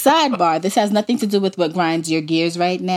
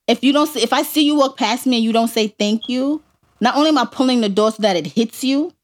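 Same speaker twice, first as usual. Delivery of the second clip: normal talking voice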